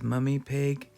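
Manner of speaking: ordinary delivery, nothing marked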